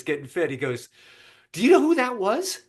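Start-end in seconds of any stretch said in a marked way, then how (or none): 1.5-2.6 high-pitched eager question; can't believe he missed it; excited. Retold or imitated. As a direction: customer asking in mock amazement. Customer excitedly asks shop owner.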